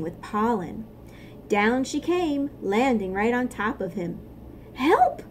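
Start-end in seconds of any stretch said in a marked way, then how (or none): 4.7-5.2 high squawked cry for help; a startled ant squawking for help. Acted. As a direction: squawk in alarm. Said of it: "he squawked".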